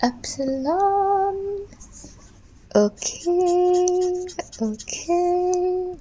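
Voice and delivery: high, drawn-out goofy voice; silly put-on voice. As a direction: stretch the words out in a funny high voice. Silly voice while working.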